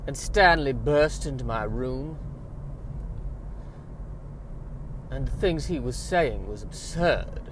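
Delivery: slow Southern belle drawl; languid, melodramatic Southern accent with long pauses; Blanche Dubois. Pretend. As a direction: play a badly faked Southern belle. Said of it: "I was aiming for Blanche Dubois".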